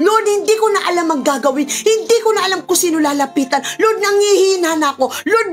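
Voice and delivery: a high, pressed plea of someone worn out; pleading confession. Acted. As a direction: voice a weary believer begging the Lord. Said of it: "Sabi mo kay Lord". (nagsusumamong pag-amin ng pagod na mananampalataya)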